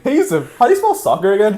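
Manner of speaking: high, loud mocking kid voice; mocking impression. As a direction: ham up a goofy classmate. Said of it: "He's like".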